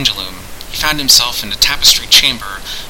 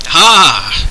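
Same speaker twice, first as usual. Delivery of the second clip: higher, louder rueful cry; rueful exclamation; 5 semitones higher, 5 dB louder than usual. A narrator's reading. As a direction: exclaim with regret. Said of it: "exclaimed Turgeon ruefully".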